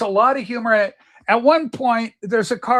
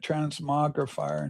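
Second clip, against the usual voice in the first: slow drawn out; 6 semitones lower, 8 dB quieter, slower than usual. Shown as a demonstration. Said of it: "searching, sounding out the word".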